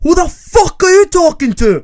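high, loud mock British shout; hammed-up angry Brit; confrontational British yell. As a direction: round on someone, spoiling for a fight. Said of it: "han snur seg bare".

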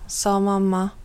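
plain reading voice

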